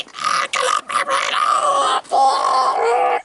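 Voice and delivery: shrieking, high and panicky, fighting off worms; hammed-up screaming for help; mock terrified screams. Reviewer acting out a worm attack, shrieking in hammed-up terror.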